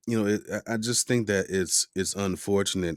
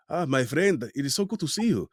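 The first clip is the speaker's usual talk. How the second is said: bad Spanish accent; 5 semitones higher, faster than usual. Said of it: "you're saying".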